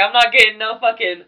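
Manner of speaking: loud, high angry rant; angry scolding. Retold or imitated. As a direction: tear into him, loud and high. Scolding the underage guest.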